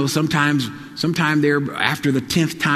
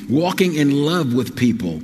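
ordinary talk

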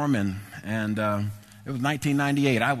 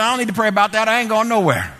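retold stubborn refusal, loud and higher; indignant refusal; 7 semitones higher, 6 dB louder, faster than usual. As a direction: dig in your heels, preacher acting out his anger. Retold argument with his wife.

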